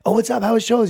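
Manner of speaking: light, overly nice grandma-style pleasantries; grandmother treatment. Retold or imitated. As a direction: chirp it like greeting a grandma. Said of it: "I have them on grandmother treatment".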